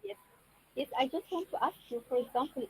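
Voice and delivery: speaking very softly, too low; barely audible, far from the mic. Real, not acted. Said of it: "your voice is very low".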